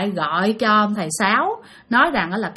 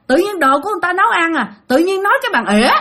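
higher, fast, odd whiny voice; offended possessed father, repeated; 8 semitones higher, faster than usual. Retold or imitated. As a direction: repeat the grudge in a put-on voice. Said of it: "Nói vậy đó".

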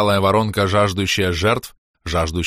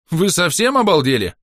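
high, raised outraged question; indignant; 8 semitones higher, 4 dB louder than usual. A narrator's reading. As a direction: protest in disbelief at the demand. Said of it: "Настала пора мне возмущаться". (возмущённо, на повышенном тоне)

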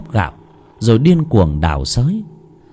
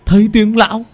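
gleeful; 10 semitones higher, 5 dB louder than usual. Acted. Servant gloating over death.

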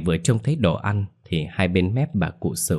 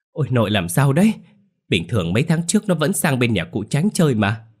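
higher and louder, alarmed disbelief; startled; 6 semitones higher, 4 dB louder than usual. A narrator's reading. Grandson alarmed by his grandmother's outburst, startled cry. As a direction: blurt it out in shock.

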